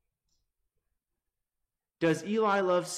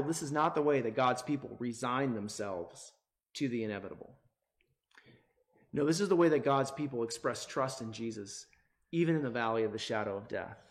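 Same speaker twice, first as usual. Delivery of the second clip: ordinary talk, nothing marked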